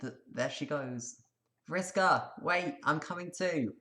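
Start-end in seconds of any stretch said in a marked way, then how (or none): 1.7-3.7 higher, louder call, slow; calling after. Acted. Tavros calling after Vriska as she leaves, louder.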